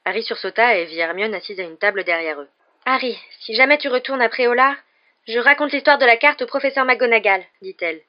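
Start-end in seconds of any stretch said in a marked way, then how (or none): 2.9-7.4 high, loud, scolding warning; indignant, high-pitched ultimatum; stern threat. A narrator's reading. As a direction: threaten to tell, voice raised. Hermione threatens to tell on Harry.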